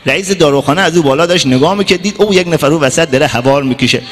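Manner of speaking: usual speaking voice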